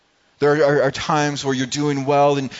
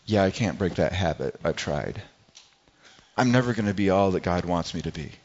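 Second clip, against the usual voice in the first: defeated self-talk; 6 semitones lower, 3 dB quieter than usual. Retold or imitated. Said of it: "Some of you are saying".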